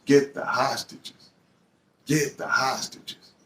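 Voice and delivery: lightly voiced officer, stretched out; cop command.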